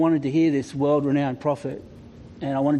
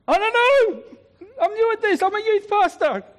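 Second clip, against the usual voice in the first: high, flustered, nervous squeak; panicked; 3 dB louder than usual. Retold or imitated. Youth pastor panicking at the deathbed, high flustered squeak. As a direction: stammer it out high and helpless.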